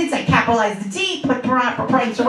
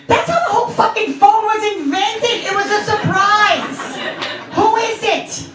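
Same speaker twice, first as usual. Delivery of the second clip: high-pitched, worked-up explanation; exasperated rant; 6 semitones higher than usual. Retold or imitated. Acted rant at her son.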